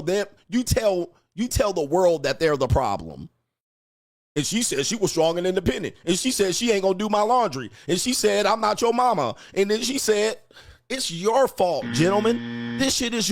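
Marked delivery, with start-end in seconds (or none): 4.4-13.3 higher, complaining singsong; mock whiny man. Podcaster mimics men complaining about women in a raised whine. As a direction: whine out the list of grievances. Mocking men's complaints, high voice.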